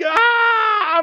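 long, loud, high mimicked wail; imitated child's scream. Preacher demonstrating children's absolute mayhem, screaming wail. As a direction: scream like an inconsolable little child. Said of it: "They're just, just screaming".

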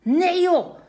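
loud, high correcting call. A narrator's reading. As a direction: call out to set them straight. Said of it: "roept Simon".

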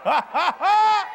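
staged laugh climbing high and loud; demonstrated laugh. Shown as a demonstration. Preacher showing he is just laughing, loud staged laugh. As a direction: laugh it out, last ha biggest. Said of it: "I'm just laughing".

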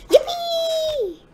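high, drawn-out playful squeal; excited high-pitched yippee; gleeful cheer. Pretend. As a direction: whoop it as you leap off. Jumping off the balcony.

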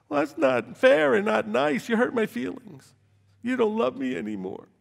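high, put-on sulky child voice; mock hurt whining. Mocking listeners with hurt feelings, high and whiny. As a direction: whine like a wounded child, hammed up.

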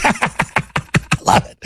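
helpless, wheezy run of laughs; real laughing fit round a few words; amused laughter. Real, not acted. Host cracking up at his own anecdote, real laughter.

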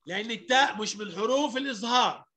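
ordinary talk, nothing marked

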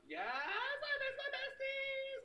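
high, drawn-out yell; excited wail. Real, not acted. Streamer asked about missing friends, high excited wail. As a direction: wail it loud and very high, stretching words.

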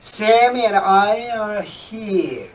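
very slow, clear reading of the sentence; slow model reading. Shown as a demonstration. Teacher starts example three with a slow reading. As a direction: read it very slowly for learners. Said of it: "Number three".

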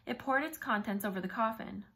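ordinary delivery

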